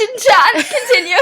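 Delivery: high, loud laughing; giggling through the words. Real, not acted.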